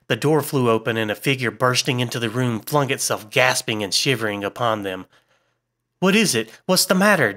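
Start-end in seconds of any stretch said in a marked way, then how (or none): 6.0-7.3 high-pitched, alarmed questioning; urgent demand. A narrator's reading. Malcolm demanding answers of the gasping figure, urgent. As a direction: demand to know what happened. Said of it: "demanded Malcolm".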